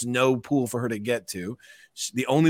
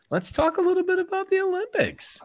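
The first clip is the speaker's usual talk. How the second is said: much higher put-on host voice; mock announcer. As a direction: announce the topic like a game show host. Host announces Olympics topic.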